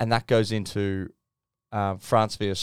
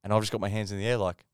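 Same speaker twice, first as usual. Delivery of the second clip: ordinary talk, nothing marked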